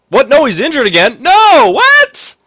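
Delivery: high, loud startled outcry; can't believe the bad news; shocked disbelief. Real, not acted. Gamer reacting to star player's injury, shocked outcry.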